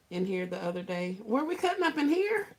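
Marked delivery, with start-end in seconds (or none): 1.2-2.5 high-pitched feigned surprise; who, us? pretend-shocked squeak; mock innocence. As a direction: play innocent, voice shooting way up. Playing innocent about cutting up.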